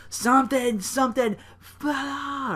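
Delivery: high nonsense shout; mock yell. Mimicking Sheamus' entrance yell, high. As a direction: garble it like a war cry. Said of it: "He's like".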